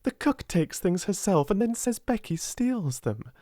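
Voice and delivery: a hint of a hurt schoolgirl; girl defending her friend, lightly voiced; indignant girl. A narrator's reading. Sarah voiced by reader.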